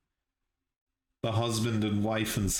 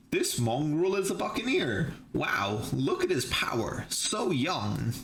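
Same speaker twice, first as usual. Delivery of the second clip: lightly voiced slaver, pitched up at first; mock amazed onlooker; 5 semitones higher than usual. Slavers sizing up their new slave, mock amazed. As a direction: marvel in a lightly put-on higher voice.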